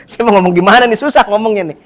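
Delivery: embarrassed chuckle then laughing words. Real, not acted. Preacher laughing at his own marriage story, laughing. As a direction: laugh it off, a little awkward.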